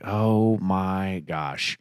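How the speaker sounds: very slow, stretched-out words; mock-weary slow motion; drawn-out exasperation. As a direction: drag each word like the crawling movie. Mimics impatience at a slow movie.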